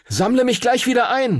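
high-pitched call to a partner. A narrator's reading. Henry to his dragon before letting go, calling out. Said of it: "rief er Phoenix zu".

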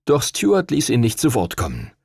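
usual speaking voice

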